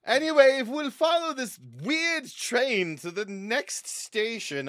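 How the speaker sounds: hammed-up museum guide; tour guide announcement. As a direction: present the final exhibit with pomp.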